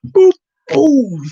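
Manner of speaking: high boop, loud boom; punchy mouth sound effects; imitated flipping noises. Shown as a demonstration. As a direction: voice the flip with cartoon noises. Imitates flipping groceries at the till.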